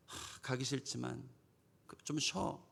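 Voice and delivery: soft, sighing reluctance; reluctant child. Acted. Child who hates going to school, soft reluctant sigh. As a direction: sigh it out, mildly dragging your feet. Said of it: "자녀가 학교 가는데 맨날".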